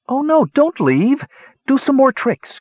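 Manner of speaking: very high, put-on female voice; high voice of disappointed women begging; women's dismayed cries. A narrator's reading. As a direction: plead in a high-pitched wail of dismay. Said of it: "cried the women in dismay".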